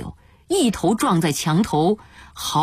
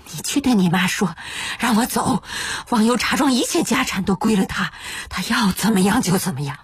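strained, coaxing plea rising to a wail; desperate begging; 5 semitones higher than usual. Acted. Father begging his eldest son to release him, desperate. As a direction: implore him tearfully, pitch climbing at the end. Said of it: "只好再去求大儿子".

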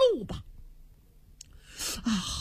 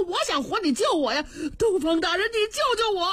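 desperate plea; 8 semitones higher than usual. Acted. Hanging official begs for rescue.

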